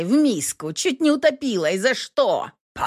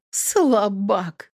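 quiet mocking; 3 semitones higher than usual. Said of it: "тихое, насмешливое".

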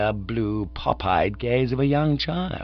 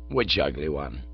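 low, softer father voice; indulgent father; 6 semitones lower, 5 dB quieter than usual. A narrator's reading. Father questioning his son, low and indulgent. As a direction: ask the child, pitched well down.

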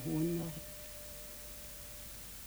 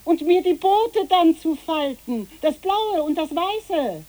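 calling out; 9 semitones higher, 10 dB louder than usual. Acted. Calling more errands after children.

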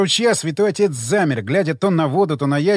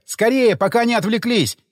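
high, pressing cry; urgent shout; 6 semitones higher than usual. A narrator's reading. Hero urges the pastor before the lizards notice, urgent shout. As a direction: shout to hurry him along. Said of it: "закричал я".

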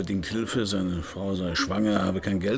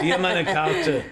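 laughing; 6 semitones higher, 5 dB louder, slower than usual. Acted. Second try with the right card, amused.

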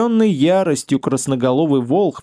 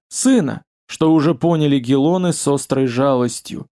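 ordinary delivery

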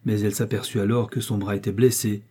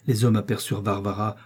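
ordinary delivery

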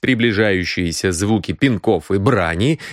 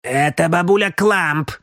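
put-on old housekeeper cutting in, pitched higher; piercing voice; 7 semitones higher than usual. Old housekeeper interrupts the young man, piercing voice. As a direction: butt in with a piercing old woman's voice. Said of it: "перебила Пола пронзительным голосом".

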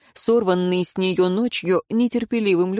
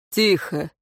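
ordinary delivery

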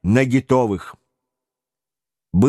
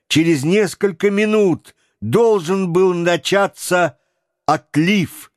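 ordinary delivery, nothing marked

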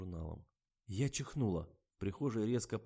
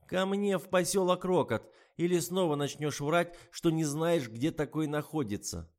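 mocking old woman; 8 semitones higher, 8 dB louder than usual. A narrator's reading. Anna Mikhailovna mocks the writer and introduces herself, high and loud. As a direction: sneer at her acting.

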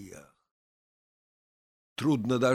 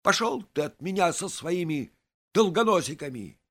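high, irritated outburst; angry shout; 6 semitones higher, 4 dB louder than usual. A narrator's reading. Narrator, foot just stepped on, shouts angrily at Vaska. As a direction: snap it out loud and cross. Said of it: "крикнул ему со злостью".

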